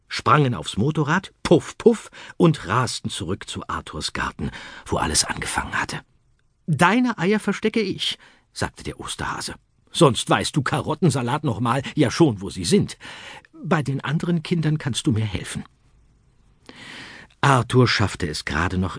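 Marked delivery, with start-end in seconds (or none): 1.4-2.1 much higher, louder engine puffs; motorbike puff. A narrator's reading. Motorbike noise imitated. 6.7-15.6 much higher, eager rabbit voice; a polite, busy Easter Bunny. Acted. Easter Bunny speaks to Arthur.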